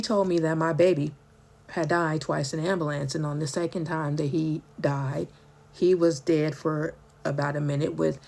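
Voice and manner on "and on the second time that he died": normal talking voice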